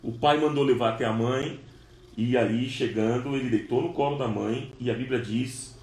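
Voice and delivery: ordinary delivery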